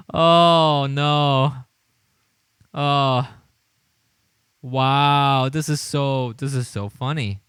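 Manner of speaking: ordinary talk, nothing marked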